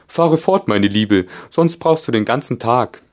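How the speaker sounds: high, loud impatient gryphon. A narrator's reading. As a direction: hurry her along. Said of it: "sagte der Löwengreif". (Greifenstimme, hoch und ungeduldig)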